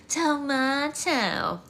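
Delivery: "Tomato." drawn out slow, posh British to-MAH-to; fancy tomato. Retold or imitated. Narrator mocking prince's posh "Tomato.", slow to-MAH-to. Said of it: "in the most obnoxiously fancy way possible".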